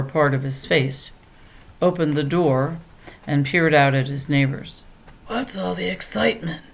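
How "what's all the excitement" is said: higher, soft, hoarse whispered voice; lion disguised as a lady, hoarse and soft. A narrator's reading. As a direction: rasp it out like you're hiding something. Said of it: "he said in a hoarse whisper".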